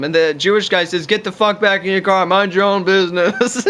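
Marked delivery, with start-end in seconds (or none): 1.1-3.6 mildly heated imitation of a confrontation; mock angry retort. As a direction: play an irritated man telling someone off, lightly hammed-up. Acted quarrel in retold road standoff.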